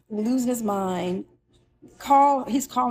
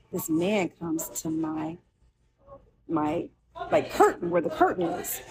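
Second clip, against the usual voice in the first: ordinary delivery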